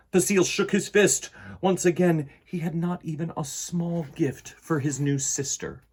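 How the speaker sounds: put-on storybook narration for kids; reading to children. A narrator's reading. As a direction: read the story aloud to the class. Reads picture book to children.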